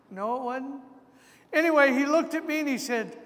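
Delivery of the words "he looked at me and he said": normal talking voice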